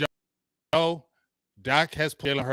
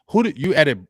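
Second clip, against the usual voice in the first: loud challenge; 7 dB louder than usual. Real, not acted. Heated challenge in argument.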